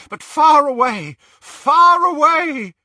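rising to a loud, high wail; anguished narration. A narrator's reading. Narrating a broken killer fleeing in pain and terror, anguished.

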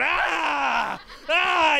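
hammed-up wailing scream; crying scream. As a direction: scream at the tree, half sobbing. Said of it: "He's, like, crying".

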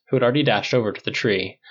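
usual speaking voice

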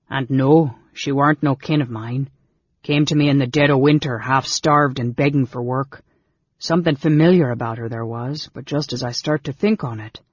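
rustic innkeeper, blunt and forceful. Acted. Innkeeper explaining the dead girl to strangers, blunt. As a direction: tell it bluntly in country speech. Innkeeper's figure in read story.

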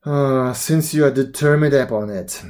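mildly sighing reluctance. A narrator's reading. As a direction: open on a sighed word, then yield. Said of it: "he answered with a sigh".

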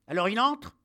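high, very loud, rising question; impatient shout. Acted. Captain waiting for the dog to enter, impatient shout.

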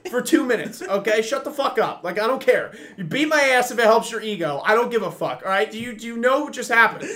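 high-pitched, worked-up tirade; comic rant. Real, not acted. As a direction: rail at them, exasperated and half joking. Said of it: "rant over".

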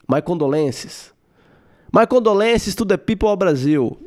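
put-on thick Brazilian-accented English; Amanda Nunes. Fighter sending condolences to Brazil, heavy Brazilian accent. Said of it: "inglês muito puxado".

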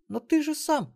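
put-on very high girl's voice, cut off; protesting granddaughter. A narrator's reading. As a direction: start a puzzled objection, pitched high. Stunned granddaughter starts to object. (высокий голос внучки, растерянное возражение)

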